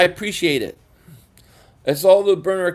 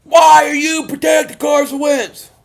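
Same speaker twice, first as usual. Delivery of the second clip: high, loud mock shout of angry fans; imitating an angry fan, high-pitched and loud; mocking critics; 9 semitones higher, 8 dB louder than usual. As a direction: yell it like an outraged fan. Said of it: "and they're like".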